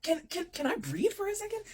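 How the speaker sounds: very high, stammering mock plea; high-pitched, overwhelmed protest; exasperated plea. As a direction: squeak it out as if begging for air. Acted plea for space.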